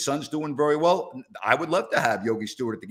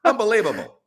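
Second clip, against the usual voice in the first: high, loud exasperation; exasperated outburst; 6 semitones higher, 5 dB louder, faster than usual. Real, not acted. Host scolding listeners who get names wrong, exasperated outburst. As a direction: burst out in disbelief at them.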